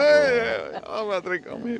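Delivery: put-on high drunken insistence; boozy high-pitched offer, easing off in volume; happy drunk. Happy drunk offering a stranger a drink, high and merry. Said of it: "The ones that say".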